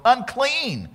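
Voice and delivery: loud, high, slow warning cry; leper calling out. Acted. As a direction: call out to warn people to stay back. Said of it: "they came upon somebody and go".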